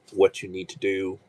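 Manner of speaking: usual speaking voice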